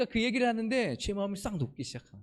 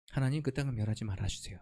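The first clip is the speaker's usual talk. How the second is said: low, softer plea in prayer; praying Abraham; 10 semitones lower, 5 dB quieter than usual. Retold or imitated. Abraham begs God to spare the land, low and soft. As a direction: drop into a quiet, humble entreaty. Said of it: "어떻게 해요?".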